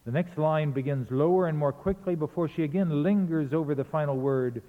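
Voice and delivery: ordinary delivery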